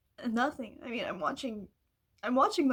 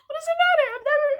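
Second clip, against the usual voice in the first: higher, louder tearful repeat; crying; 10 semitones higher, 6 dB louder, much faster than usual. Real, not acted. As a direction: cry it out again, pitch higher.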